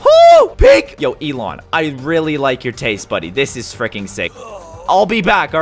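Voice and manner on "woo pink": very high, loud cheer; thrilled whoop. Real, not acted. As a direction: whoop with delight at the prize. Thrilled by the pink car.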